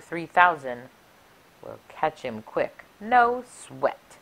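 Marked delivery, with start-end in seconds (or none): none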